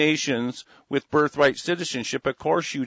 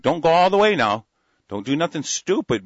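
raised warning voice; louder, higher warning; 5 semitones higher, 5 dB louder than usual. Retold or imitated. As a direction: call it out as a warning. Voicing elites' orders.

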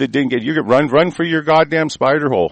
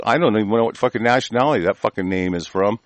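ordinary delivery

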